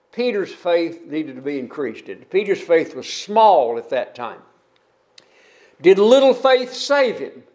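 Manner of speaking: usual speaking voice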